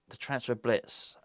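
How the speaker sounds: a hushed slow echo of the phrase; quiet echo. Real, not acted. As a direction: murmur "The transfer blitz" to yourself. Echoes the phrase.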